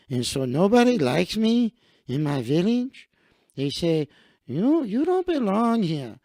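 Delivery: earnest storytelling in a put-on Mexican accent; halting, silly Mexican-accented English. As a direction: play the hopeful recruit with a Mexican accent. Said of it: "I will put on my Mexican voice".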